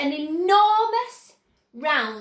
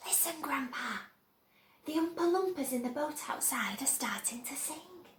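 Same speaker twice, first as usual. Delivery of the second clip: soft, breathy whisper; 9 dB quieter than usual. A narrator's reading. Charlie whispering to Grandpa, soft whisper. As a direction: whisper it secretly.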